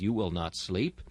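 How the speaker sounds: usual speaking voice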